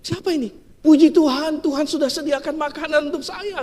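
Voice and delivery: high, joyful praise; delighted old woman. Retold or imitated. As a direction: burst into thankful delight. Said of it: "Wow, dia bilang". (nenek bersukacita, suara tinggi)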